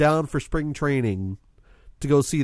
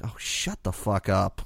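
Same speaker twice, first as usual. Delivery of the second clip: lower, softer, slow mock grumble; mock annoyed; 8 semitones lower, 6 dB quieter than usual. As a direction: grumble it, half joking. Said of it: "I'm like".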